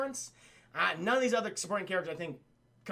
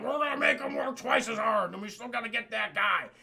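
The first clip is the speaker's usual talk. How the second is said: villain boss voice; 2 semitones higher than usual. Retold or imitated. Acting the obese villain giving orders.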